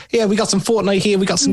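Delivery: mock New Zealand movie-sidekick accent; Thor's friend. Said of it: "Thor's friend who's like".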